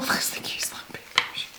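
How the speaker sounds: whispered delight after a laugh; hushed cooing. Real, not acted. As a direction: whisper it as if adoring something tiny. Delighted by a tiny brush.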